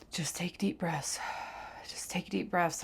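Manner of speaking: soft whispered mantra; whispered self-calming. Retold or imitated. Whispering to herself on the basement floor to calm down. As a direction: whisper it to yourself to stay calm. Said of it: "I keep telling myself".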